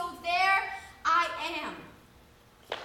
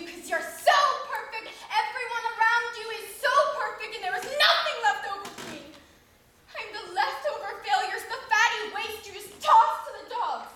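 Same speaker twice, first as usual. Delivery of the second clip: louder, fast, anguished outburst; resentful sister, bitter; 2 semitones higher, 4 dB louder than usual. Acted. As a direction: hurl the grievances at her mother. Dramatic monologue performance.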